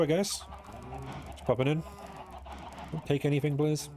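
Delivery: high, sing-song coaxing to the creature; talking to a mob. Pretend. Addressing the Enderman mob.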